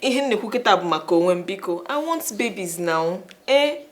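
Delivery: loud demand. Acted. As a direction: make him hear you. Wife loudly demanding.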